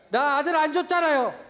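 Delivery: loud, high-pitched protest; indignant yelling complaint; angry outburst. Acted. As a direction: act out a fed-up Abraham shouting at God. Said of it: "화나고 열받고".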